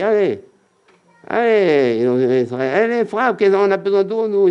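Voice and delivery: high insistent urging; mock crowd clamoring for water; demanding crowd. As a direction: push him hard, like an impatient crowd. Israelites pressing Moshe to strike.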